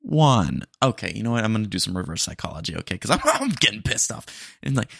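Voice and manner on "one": loud, flat machine confirmation; louder drive-thru bot voice; robot voice. Acted. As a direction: mishear like a dumb bot. AI bot mishears.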